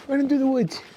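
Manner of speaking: very high, dainty helpless voice; innocent little squirrel. Acted. Storyteller introducing the little squirrel, high innocent voice. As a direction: pipe it up high like a tiny innocent creature. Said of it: "all innocent and helpless".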